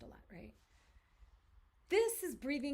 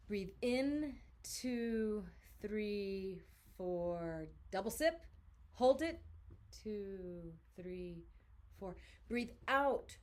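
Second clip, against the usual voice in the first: guided breathing count; 3 semitones lower, much slower than usual. Acted. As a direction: count the breath out slowly, letting the voice drop.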